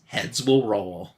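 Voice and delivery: low, slow, lightly spooky trailer read; a hint of a horror-movie announcer; ominous tagline. Host teases the next comic issue in a mild spooky voice.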